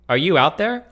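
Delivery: calling out. Retold or imitated. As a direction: call out loud, unsure if anyone's there. Calling out to girl from shower.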